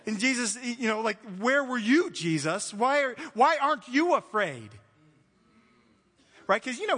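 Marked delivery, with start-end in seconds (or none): 1.4-4.7 loud, higher mock accusation, drawn out; exasperated acted challenge; indignant confrontation. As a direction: demand an answer, punching the key words. Voices disciples confronting Jesus.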